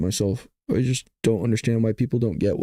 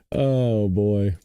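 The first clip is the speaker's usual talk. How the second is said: ordinary talk, nothing marked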